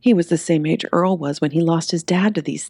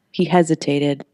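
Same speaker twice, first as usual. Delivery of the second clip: usual speaking voice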